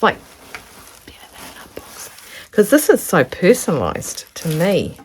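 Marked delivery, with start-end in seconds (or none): 1.0-2.5 hushed, breathless whisper of wonder; awed whisper. Real, not acted. Vlogger unwrapping a friend's fabric gift, whispering in awe. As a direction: whisper it like you can't believe it.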